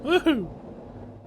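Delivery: high gleeful whoop; excited whoop. Real, not acted. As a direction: cheer on the way down. Sliding down, cheering.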